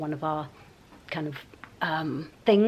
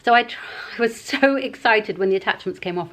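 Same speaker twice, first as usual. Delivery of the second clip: usual speaking voice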